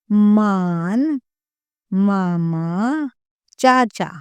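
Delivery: put-on each word drawn out slowly for children; slow clear teaching. Shown as a demonstration. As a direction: say it slowly so kids can copy. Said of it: "अब सीखते हैं आ की मात्रा के शब्द". (धीरे धीरे साफ़ बोलकर सिखाना)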